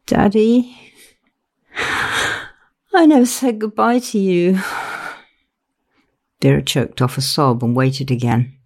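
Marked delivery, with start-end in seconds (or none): 0.1-4.6 put-on high, small child's voice; a grieving daughter gone back to childhood. A narrator's reading. Grieving daughter speaking to her dead father, child's voice. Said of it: "It was the child's voice Vera heard".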